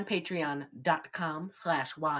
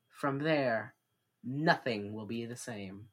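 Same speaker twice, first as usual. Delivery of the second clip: ordinary talk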